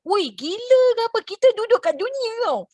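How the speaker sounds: high, incredulous mock scoff; scoffing disbelief. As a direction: scoff at the idea as if it were crazy. Friend mocks waiting for God's money.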